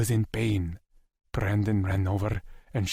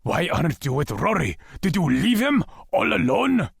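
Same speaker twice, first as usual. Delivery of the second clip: panicked accusation; 8 semitones higher, 4 dB louder than usual. A narrator's reading. Lex confronting Asher in panic.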